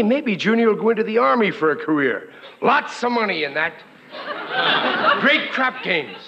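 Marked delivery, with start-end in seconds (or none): 4.1-5.2 laugh. Acted. [name] laughs at own joke.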